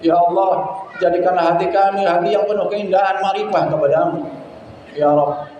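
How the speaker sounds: ordinary talk, nothing marked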